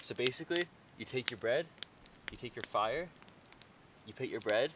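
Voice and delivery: very quiet, hushed talk. Real, not acted. Quiet night demo at camp.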